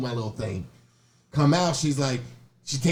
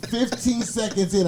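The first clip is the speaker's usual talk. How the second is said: ordinary talk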